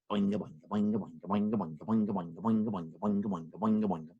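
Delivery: quiet, low bouncy boinga; soft boinga hops. Acted. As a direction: hop sound, under the breath. Rabbit hopping sound effect.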